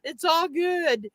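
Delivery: high, slow smug cat voice; lightly voiced smug cat; cat's voice. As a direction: drawl it like a safe pampered cat. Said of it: "Jacob's just like".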